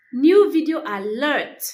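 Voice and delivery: loud, high, excited alert; excited announcement. Shown as a demonstration. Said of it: "This simply means, uh, you, you are excited".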